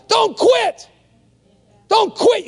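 very high, shouted command; emphatic shout. Real, not acted. Preacher urging the congregation, emphatic shout. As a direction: cry it out at the top of your range.

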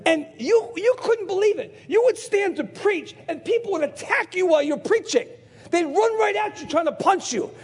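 high, urgent storytelling; excited preaching. Real, not acted. Preacher telling the congregation how people attacked him, excited preaching. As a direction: relive the attack with animated disbelief.

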